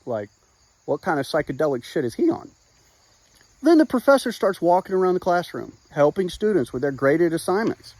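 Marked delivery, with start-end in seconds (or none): 0.1-2.5 imitated students' aside. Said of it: "a couple students are like".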